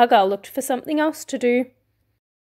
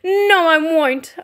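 high, slow, stubborn grumble; grumbling refusal; 6 semitones higher, 4 dB louder, slower than usual. A narrator's reading. Fox refusing Mama Fox's advice, grumbling. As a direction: drag it out in a sulk. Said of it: "Argyle grumbled".

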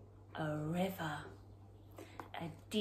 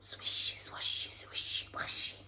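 soft hushed grass sounds; whispered swishes; 9 dB quieter than usual. Acted. Fleeing home, whispered grass sounds. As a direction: brush the words like grass.

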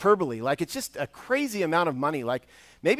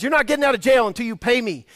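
a touch of acted anger, louder; mock berating; 6 semitones higher, 6 dB louder, faster than usual. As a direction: threaten the debtor, lightly voiced. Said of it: "he starts to really berate him".

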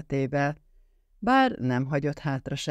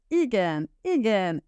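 high, eager repeated yes; happy yes; 7 semitones higher than usual. A narrator's reading. Linda retelling her answer to Carlos, eager high yes. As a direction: say yes with delight. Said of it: "azt feleltem".

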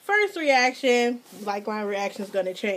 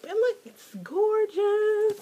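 admiring coo; 9 semitones higher than usual. Real, not acted. Admiring envelope.

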